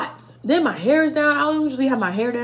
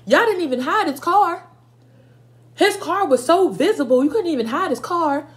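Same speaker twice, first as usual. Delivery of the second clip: retold angry yelling; 7 semitones higher than usual. Mom catching the boy in her house, yelling. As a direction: blast her like a mad mom catching you.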